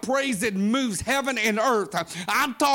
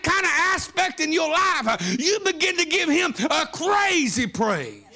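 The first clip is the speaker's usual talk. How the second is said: preaching build; 3 semitones higher than usual. Acted. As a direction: push the praise higher and higher.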